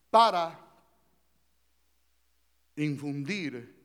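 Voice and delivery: ordinary talk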